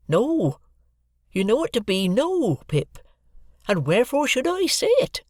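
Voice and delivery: a hint of earnest, awkward country man; Joe's voice. A narrator's reading. Joe answering Miss Havisham, lightly voiced humble blacksmith.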